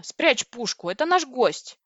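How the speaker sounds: mildly outraged order; indignant. A narrator's reading. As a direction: scold someone into backing off. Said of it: "возмутилась я".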